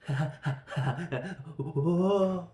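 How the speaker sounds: loud mock laugh ending in a yelp; hammed-up laugh of a villain who is falling. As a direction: cackle as you slip off the edge. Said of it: "He just starts laughing".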